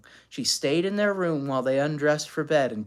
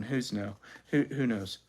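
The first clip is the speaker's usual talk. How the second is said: usual speaking voice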